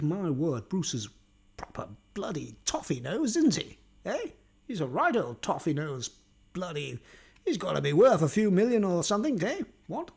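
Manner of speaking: mock posh toff with swooping pitch; posh accent. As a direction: put on a plummy upper-class English accent. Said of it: "talking like this all the time".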